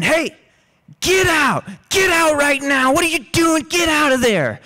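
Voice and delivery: high-pitched scolding shout; imitated angry yelling. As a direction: yell as if chasing someone out. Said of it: "We don't ever talk to Bayrock like that".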